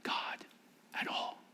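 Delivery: God at all dropped to a whisper. Shown as a demonstration. Showing God drowned out by noise, whispered. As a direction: whisper it so it nearly vanishes. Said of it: "so loud you can't hear".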